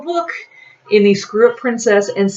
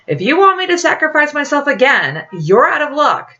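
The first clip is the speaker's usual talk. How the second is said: higher, heated, defiant protest; defiant refusal; 9 semitones higher, 3 dB louder than usual. Acted. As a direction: flatly refuse to be sacrificed again.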